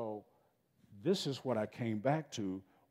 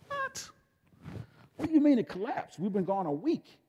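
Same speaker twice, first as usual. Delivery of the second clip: high, loud cry of disbelief; what do you mean, rising in alarm; shocked disbelief; 11 semitones higher, 8 dB louder than usual. Retold or imitated. Recalling the neighbor's news, high shocked disbelief. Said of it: "I'm like".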